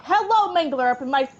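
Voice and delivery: high, loud panicked shouting; panicked yelling. Real, not acted. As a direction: yell in panic. Mangler attacks him.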